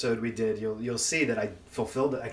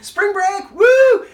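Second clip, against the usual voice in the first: high, loud spring break yell; party shout; 13 dB louder, much slower than usual. Retold or imitated. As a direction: whoop it to the crowd. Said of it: "I go".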